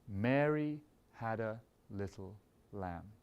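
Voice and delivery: "Mary" sung out heavy, rest lighter and slow; slow, stress on MARY. Shown as a demonstration. Conductor sings the phrase while beating heavy on Mary. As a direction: sing it slowly, weight on "Mary". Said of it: "beating the emphasized beat a little stronger".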